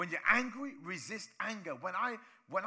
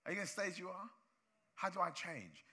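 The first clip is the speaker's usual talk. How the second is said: ordinary delivery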